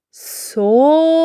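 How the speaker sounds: сова said syllable by syllable, со-ва; syllable demo. Shown as a demonstration. Teacher sounding out сова for the class. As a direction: open the jaw on each syllable of сова. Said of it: "і вимовляємо слово".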